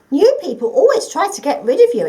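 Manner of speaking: high, loud squeaky little mouse voice. Acted. Mouse warning the forgotten creature, high and squeaky. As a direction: pipe it up like a busy little mouse.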